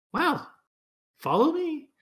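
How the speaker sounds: put-on high, loud old woman beckoning; cheerful elderly guide; old lady voice. Old lady leads party.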